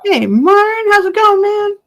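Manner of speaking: normal talking voice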